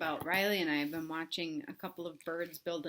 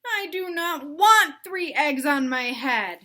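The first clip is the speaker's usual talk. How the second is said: slow, louder, weight on want; emphatic protest; firm refusal; 6 semitones higher, 11 dB louder, slower than usual. Acted. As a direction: put your foot down. Gerald refuses.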